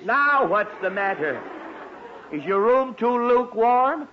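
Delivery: exasperated father asking sarcastically; sarcastic exasperation. Acted. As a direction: needle the boy with mock concern. Father snaps at son again.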